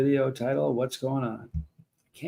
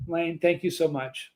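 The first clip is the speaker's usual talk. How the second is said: ordinary talk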